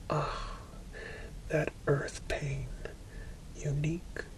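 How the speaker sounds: usual speaking voice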